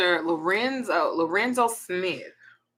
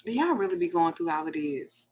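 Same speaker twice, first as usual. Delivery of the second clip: ordinary talk, nothing marked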